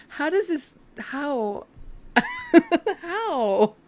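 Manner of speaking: giggling helplessly, losing composure; overwhelmed laughter. Real, not acted. Reading the card for Timmy, laughing in disbelief. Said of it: "I'm having a moment".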